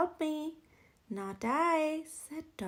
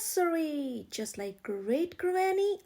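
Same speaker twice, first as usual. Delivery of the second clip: usual speaking voice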